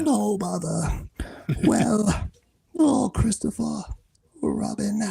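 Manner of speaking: high, slow falsetto with sand in it; Pooh Bear voice. Shown as a demonstration. Whispered sigh, then high yawning name. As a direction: guest demonstrating his Pooh, falsetto with grit. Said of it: "gravelly sand in your voice".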